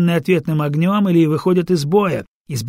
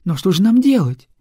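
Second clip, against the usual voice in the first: higher, louder worried question; anxious question; 5 semitones higher, 5 dB louder than usual. Acted. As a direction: ask in rising fear. Frightened singer asks.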